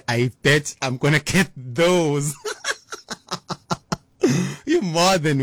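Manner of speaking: chuckling through the words, then a high breathy laugh. Real, not acted. DJ joking about listener voice notes, laughing. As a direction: laugh at your own joke.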